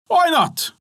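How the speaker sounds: high, quick yes from the miller; the miller readily agrees to go along. Acted. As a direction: toss it off lightly.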